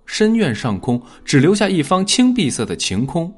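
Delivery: ordinary delivery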